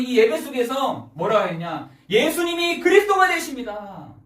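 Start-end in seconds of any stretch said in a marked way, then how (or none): 2.1-4.1 high, raised-voice confession of faith; ringing declaration, pitched high; fervent proclamation. Acted. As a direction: proclaim it from the pulpit with force. Confession proclaimed, then repeated low.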